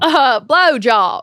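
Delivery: slow Southern drawl on blowjob; Southern accent. Pretend. Cohost answers the Southern accent prompt, drawling. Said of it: "which is Southern".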